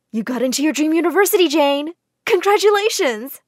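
high, loud and quick; excited congratulations. A narrator's reading. Counselor telling Jane news, excited. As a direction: rush out the good news.

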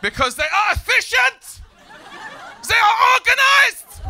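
hammed-up German bellow; mock German shouting. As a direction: ham up a ranting German.

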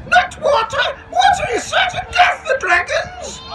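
extremely high, loud panicky squeal; shouting dragon. A narrator's reading. Dragon warns the station master off the fire bucket, squealed shout. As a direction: plead at the top of a squeaky creature voice. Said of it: "shouted the dragon".

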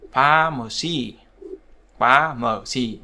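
Pharmacy drawn out slowly, PHAR leading; slow teaching demo, PHAR-ma-cy; stress on first syllable. Shown as a demonstration. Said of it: "vào âm tiết thứ nhất".

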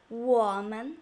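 "我们" deep dipping 我, light 们; light neutral tone. Shown as a demonstration. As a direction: sink 我 low, lift lightly on 们. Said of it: "make a neutral tone very light".

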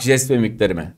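ordinary talk

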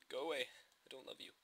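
ordinary delivery

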